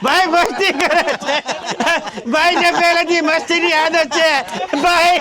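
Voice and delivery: high, urgent protests that it was a joke; panicked backpedalling. Acted. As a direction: hastily talk your way out of a beating. Backpedals when threatened with a beating. (ગભરાઈને ઊંચા અવાજે વાત વાળવી)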